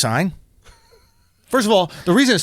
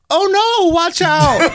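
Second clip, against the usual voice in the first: high-pitched mock girlfriend alarm; girlfriend's warning; 9 semitones higher, much slower than usual. Girlfriend warning him as he texts, high mock alarm. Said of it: "I just hear my girl go".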